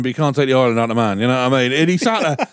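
ordinary talk

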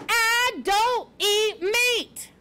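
high, slow storybook scream. A narrator's reading. Porcupine insisting to Poppy on his diet, screaming. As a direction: pound out each word, high and drawn out. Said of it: "Aerith screamed".

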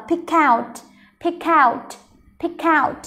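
"pick out" joined as one, the K starting OUT; linked sounds. Shown as a demonstration. Teacher showing learners linking, PICK OUT run together as pick-kout. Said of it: "nó sẽ nối âm với âm out".

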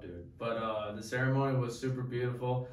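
normal talking voice

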